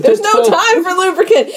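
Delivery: high-pitched frantic objection; hammed-up rush of urgency; mock urgency. Doctor dismissing lubricant in an emergency, mock-frantic.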